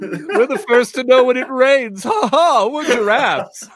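very high, gloating giraffe voice with a cackle; snooty giraffe voice. Acted. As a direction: crow about it from way up high. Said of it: "I love the voice that you just did for a giraffe".

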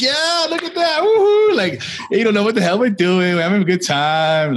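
high giddy cheering. Pretend. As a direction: whoop like a kid let loose. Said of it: "imagine myself in this thing".

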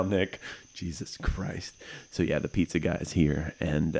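ordinary talk, nothing marked

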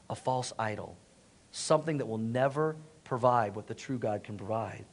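low, hushed solemn preaching; soft, heavy-hearted reflection; sad, sobered. Real, not acted. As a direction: grieve quietly over a lost people. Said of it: "this is where it gets sad".

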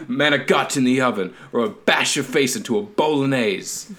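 hammed-up angry Italian family man; mock Italian accent. Host playing angry Italian family, exaggerated Italian accent. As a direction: threaten in a comic Italian accent. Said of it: "That's an Italian accent".

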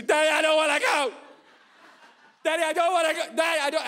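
second daughter whining no; whining little girl. Retold or imitated. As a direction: beg like a frightened small girl. Sienna joins refusing.

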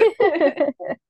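loud real laugh. Real, not acted. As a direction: burst of laughter. Laughs at own remark.

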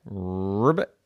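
ordinary delivery, nothing marked

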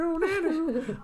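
high wailing mouth-made guitar lick; vocal guitar riff. Pretend. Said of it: "that's my guitar riff".

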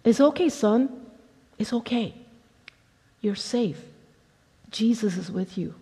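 put-on soft, gentle hush to a scared child; calm him down softly, like a mother at the bedside; reassuring mother. A narrator's reading. Mother comforts frightened son.

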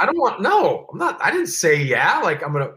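put-on young player flustered at being hustled, high and panicky; mock panic. Panicked protest to Joey Porter.

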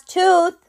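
incredulous punch on "tooth"; outraged emphasis. Real, not acted.

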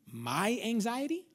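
slow questioning echo, rising at the end; incredulous echo. Acted. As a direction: stretch it out as a challenging question. Throws the excuse back, questioning.

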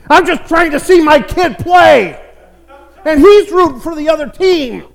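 mock sorry. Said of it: "I looked at him and said".

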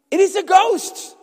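high, loud terrified cry of a ghost; frightened disciples crying out at a ghost. A narrator's reading. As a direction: cry it out in fear as if seeing a ghost. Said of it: "and cried out in fear".

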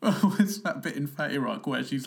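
normal talking voice

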